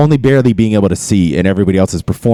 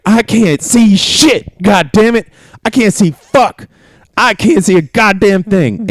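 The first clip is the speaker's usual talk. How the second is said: frustrated recruit; 13 semitones higher than usual. Retold or imitated. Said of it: "my ass is like".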